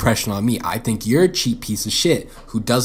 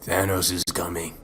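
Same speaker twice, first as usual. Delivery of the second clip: Hulk's warning; 6 semitones lower, 5 dB quieter than usual. Retold or imitated. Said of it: "But then he says".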